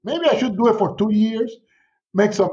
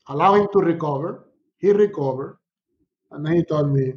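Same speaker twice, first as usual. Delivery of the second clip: ordinary talk, nothing marked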